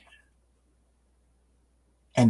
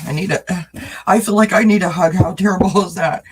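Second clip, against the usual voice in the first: emotional plea; 7 semitones higher, 8 dB louder than usual. Real, not acted. Moved, asks for hug.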